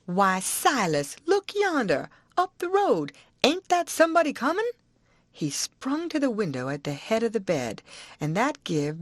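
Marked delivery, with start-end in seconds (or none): 0.1-4.7 much higher, eager cry; excited aunt. Acted. Aunt to husband, much higher excited call. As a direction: sell the trick with delight.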